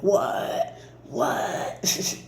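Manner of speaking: breathless whispered disbelief, barely voiced; stunned whisper. To viewers, when big abundance rolls in: whispered awe. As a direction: gasp it out as if you can't believe your luck. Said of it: "you're gonna be like".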